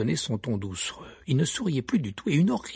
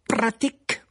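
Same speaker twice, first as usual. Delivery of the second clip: high, loud startled echo; Karkaroff surprised; 11 semitones higher, 5 dB louder, slower than usual. A narrator's reading. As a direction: toss the word back in disbelief. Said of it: "s'étonna Karkaroff". (Karkaroff étonné, voix haute)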